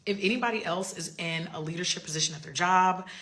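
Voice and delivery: ordinary delivery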